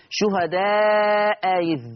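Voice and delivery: شهداء إذ drawn out very slowly; slow recitation. Shown as a demonstration. Teacher recites the phrase slowly for students. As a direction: recite شهداء إذ with long, measured vowels. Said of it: "teacher recites a Quran phrase".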